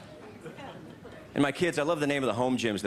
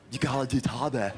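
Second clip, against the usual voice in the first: lightly voiced dude friend; friend's way of talking. Comedian mimicking how his friends talk about Tae Bo. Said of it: "my friends talk like that".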